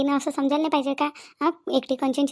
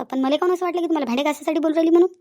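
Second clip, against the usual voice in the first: ordinary delivery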